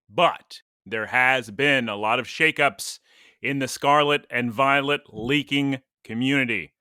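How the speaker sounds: ordinary talk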